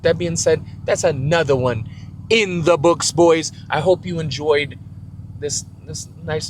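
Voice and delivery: loud, high sign-off shout; hyped outro. Real, not acted. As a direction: pump up the crowd at the end.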